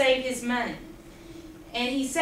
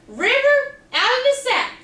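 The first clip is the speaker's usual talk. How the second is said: high, louder order to the sack; commanding call; 10 semitones higher, 5 dB louder than usual. Acted. Quackling calling River out to put out the fire.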